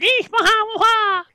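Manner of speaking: high, loud pixie squeal. Acted. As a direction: squeal it like a tiny trickster. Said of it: "I really like your pixie impression".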